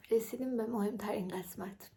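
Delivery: hushed secret whisper. Acted. Vlogger whispering to viewers before the big tip.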